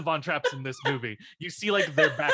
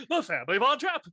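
high, laughing grand announcement; mock announcer; 10 semitones higher than usual. Friend replaying the spotlight moment, high laughing announcer. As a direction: call the winner out like a pageant host.

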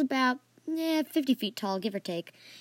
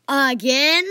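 loud exasperated outburst; frustrated; 4 semitones higher, 10 dB louder than usual. A narrator's reading. Archie reacting to yet another turnip dragon, frustrated. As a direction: blurt it out louder, exasperated. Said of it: "clearly flush-, frustrated".

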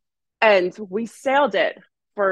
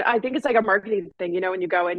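ordinary talk, nothing marked

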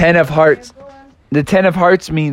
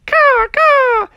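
eagle caw. Acted. Eagle swoops in.

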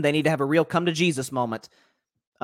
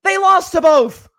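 loud, slow, higher shout; punchline shout; 13 semitones higher, 11 dB louder, slower than usual. Real, not acted. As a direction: shout it out as the reveal.

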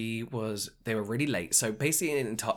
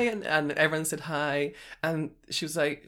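ordinary talk, nothing marked

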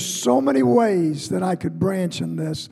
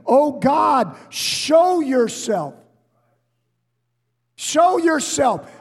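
crying out to God; 6 semitones higher, slower than usual. Retold or imitated. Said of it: "he cries out".

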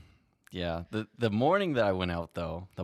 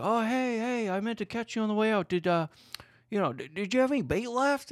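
much higher, louder mock voice of an eager stranger; eager fisherman voice; 4 dB louder than usual. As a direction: squeak it out like a hopeful guy begging for bait. Voicing the shoreline fisherman.

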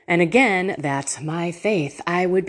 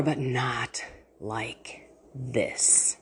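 slow, soft whisper; 6 semitones lower, 10 dB quieter, much slower than usual. Real, not acted. Host promising listeners her prayers, slow whisper. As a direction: lean in and whisper it slowly.

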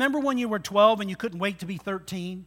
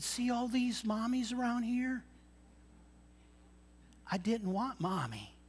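a lightly voiced small boy, much softer; little boy; 13 dB quieter than usual. Lost boy tells his mom why he used her name, soft child voice. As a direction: speak it small and tender, like a child.

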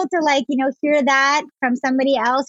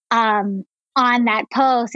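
usual speaking voice

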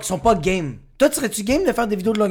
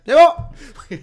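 instant approval; 8 semitones higher, 7 dB louder than usual. Retold or imitated. Imitating producer stopping the accent audition.

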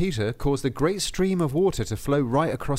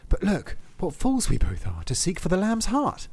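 higher, mock-earnest reasoning; sly soldier; 8 semitones higher than usual. Lustig to the apostle, mock-earnest and raised. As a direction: talk him round with fake logic. Lustig argues lamb has no heart.

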